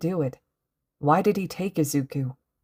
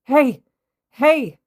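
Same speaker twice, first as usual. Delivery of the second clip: loud, much higher call; 10 semitones higher, 10 dB louder than usual. A narrator's reading. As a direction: flag someone down from afar. Calling to police officer.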